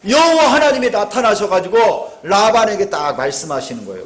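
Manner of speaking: ordinary talk, nothing marked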